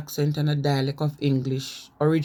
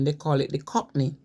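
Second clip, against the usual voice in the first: ordinary delivery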